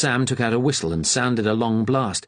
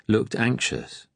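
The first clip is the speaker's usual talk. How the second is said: ordinary delivery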